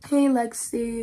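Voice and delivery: normal talking voice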